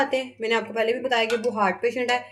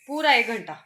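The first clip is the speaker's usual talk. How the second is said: ordinary talk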